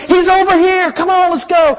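calling out excited. Acted. Crowd member calling out to others.

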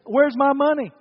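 indignant demand. Acted.

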